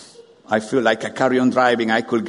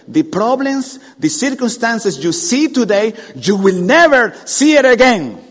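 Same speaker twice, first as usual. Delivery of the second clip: higher, louder preaching climax; rising preaching; 8 semitones higher, 7 dB louder than usual. Acted. Preacher promising deliverance, rising fervor. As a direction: drive the promise home.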